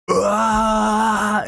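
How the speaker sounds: long, high mock climax cry; drawn-out silly high-pitched "ah"; hammed-up wail played for laughs; crazy yell. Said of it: "make some crazy ass noises".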